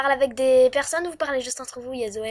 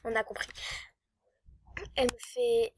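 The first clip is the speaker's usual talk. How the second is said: ordinary talk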